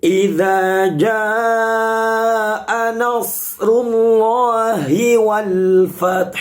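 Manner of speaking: slow, drawn-out chanted Quran recitation. Shown as a demonstration. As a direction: recite the verse slowly, melodically. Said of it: "Saya ulangi". (tilawah Al-Quran yang lambat dan berirama)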